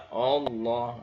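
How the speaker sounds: "Allah" said with a rounded O at the start; wrong O-sound. Shown as a demonstration. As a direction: show the mistake: "Allah" like "Ollah". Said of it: "ini salah".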